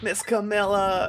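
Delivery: high, slow worried call. Acted. Character calls out slowly.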